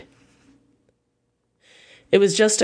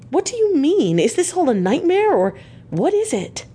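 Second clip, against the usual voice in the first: very high, distraught questioning; high-pitched anguished disbelief; aghast; 11 semitones higher than usual. A narrator's reading. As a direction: plead in bewildered horror, pitch soaring then dropping. Arthur aghast at hearing undead.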